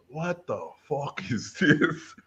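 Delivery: high, slow mock disbelief; astonished. Reviewer discovering the student's painting, slow high astonishment. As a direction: stretch it out in imitated amazement.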